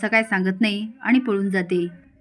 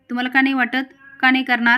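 higher, louder indignant challenge; angry retort; 4 semitones higher, 6 dB louder than usual. A narrator's reading. Lali challenges the doubt about the wedding, angry. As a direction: fire back crossly, pushing the questions. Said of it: "लाली रागाने म्हणते".

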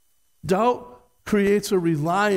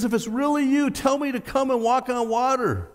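eager Peter; 7 semitones higher than usual. Retold or imitated. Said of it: "Peter says".

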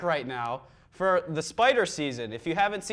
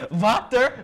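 loud, higher German attempt; mock German accent; 6 semitones higher, 8 dB louder than usual. Host attempting the German word, loud mock German. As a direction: try the word as a barked German.

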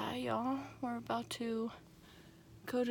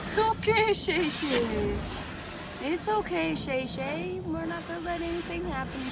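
reassuring; 6 semitones higher than usual. Real, not acted. Comforting shaking dog.

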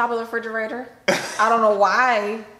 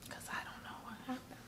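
whisper; 5 semitones higher, 21 dB quieter, faster than usual. Acted. Secretive aside.

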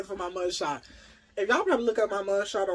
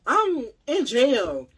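loud, high, drawn-out exclamation; exasperated; 7 semitones higher, 8 dB louder, much slower than usual. Retold or imitated. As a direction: stretch it out in loud disbelief. Explains why flirting was unwelcome.

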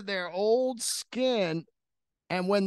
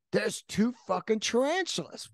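normal talking voice

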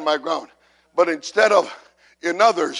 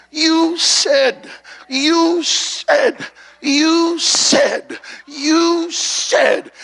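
high, drawn-out cry, over and over; insistent pleading prayer; 8 semitones higher, much slower than usual. Retold or imitated. Husband praying for his marriage in God's face, insistent cry. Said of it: "I was in the face of God all the time".

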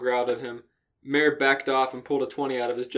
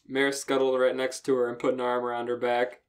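ordinary talk, nothing marked

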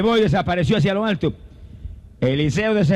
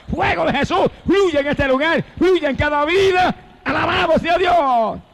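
fiery preaching; 6 semitones higher than usual. Acted. Preacher lifting the congregation with the fire of Elijah, fiery and shouting. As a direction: preach it with rising fire, let it soar.

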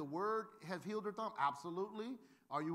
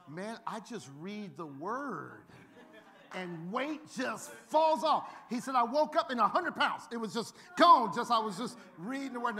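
slow, then louder and higher mock testimony; joking friend hamming it up; 4 semitones higher, 10 dB louder than usual. Friend Dan fooling a stranger, slow then booming. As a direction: ham up the miracle claim, building loud.

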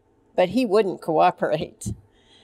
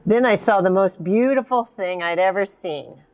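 plain reading voice

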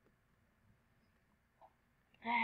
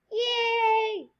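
high loud yay; happy cheer; 10 semitones higher, 8 dB louder than usual. Real, not acted. Gamer celebrating the level up. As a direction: squeal with delight.

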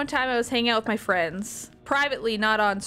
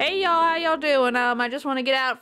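put-on Southern public speaker; Southern accent; 2 semitones higher than usual. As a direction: greet the folks with a Southern twang. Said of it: "the Southern accent Pogger".

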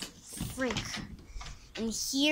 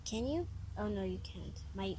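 ordinary delivery

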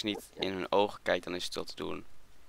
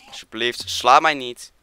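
loud plea rising to a shout; loud pleading; 4 semitones higher, 12 dB louder, slower than usual. Real, not acted. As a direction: beg loudly, peaking higher. Pleading loudly with attacking mob. (luid smeken)

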